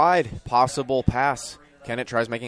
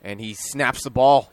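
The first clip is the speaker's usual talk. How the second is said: ordinary talk, nothing marked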